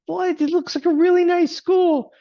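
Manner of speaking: very high, loud, baffled protest; husband's high, loud, disbelieving protest to his wife; incredulous. Retold or imitated. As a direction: squeak it out in disbelief. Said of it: "And I go".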